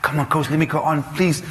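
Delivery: high, laughing plea; pleading. Retold or imitated. Player begging the coach to send him on, high and pleading. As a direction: beg the coach to let you on.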